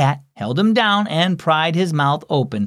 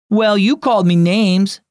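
mild retort; 6 semitones higher, 4 dB louder than usual. Acted. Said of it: "Nolan retorted".

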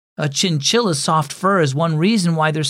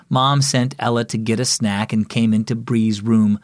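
usual speaking voice

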